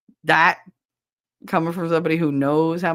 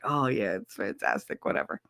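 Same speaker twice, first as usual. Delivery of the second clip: low, soft, unimpressed flattery; half-hearted mock admiration; feigned ego stroking; 10 semitones lower, 6 dB quieter than usual. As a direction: humour them in a low, dismissive murmur. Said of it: "I do the ego stroking".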